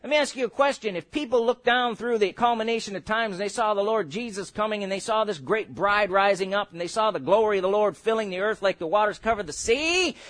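normal talking voice

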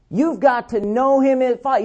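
ordinary delivery

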